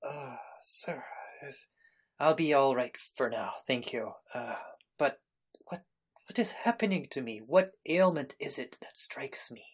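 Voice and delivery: put-on weak old man coming to, halting; ailing old man. Collapsed old man addresses his helper, weak and halting. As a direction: falter softly, with pauses, as a sick man just revived. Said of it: "He comes to, and he says".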